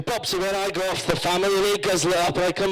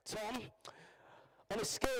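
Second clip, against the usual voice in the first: ordinary talk, nothing marked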